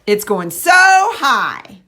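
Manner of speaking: slow, stretched and loud, smooth co-articulation; slow modeled phrase. Shown as a demonstration. Speech therapist modeling slowly for a young client. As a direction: draw the phrase out slowly for a child to copy. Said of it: "I'm varying my prosody".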